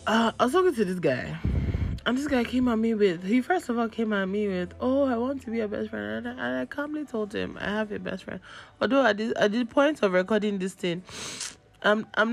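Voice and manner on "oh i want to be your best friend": ordinary talk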